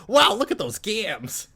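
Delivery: lightly hammed-up wowed onlooker; mock ogling. Men ogling her bikini.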